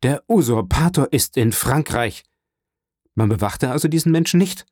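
a touch of outraged shock; angry alarm. A narrator's reading. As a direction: demand an answer in alarm. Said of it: "eine Gebärde des Zorns und Schreckens".

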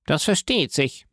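plain reading voice

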